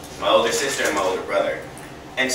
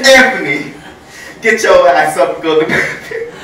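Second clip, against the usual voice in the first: loud mock scolding command; bossy grandma order; 4 semitones higher, 8 dB louder than usual. Grandmother blaming the kid for her fart, loud scold. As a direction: bark the order like a grumpy nana.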